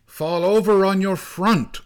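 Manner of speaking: high, loud urgent command; crying out. A narrator's reading. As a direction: shout it in passing through a storm. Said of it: "cried the snow boy".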